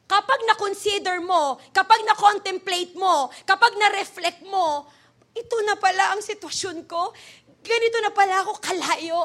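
forceful preacher's list; emphatic preaching. Acted. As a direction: press each phrase on the congregation.

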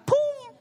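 high mock poof; poof sound effect. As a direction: pop out a cartoon vanishing sound. Sound of vanishing.

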